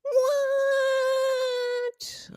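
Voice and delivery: very high, long drawn-out squeal; exaggerated disbelief. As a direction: stretch it into a piercing falsetto cry. Reacting to audio cutting out.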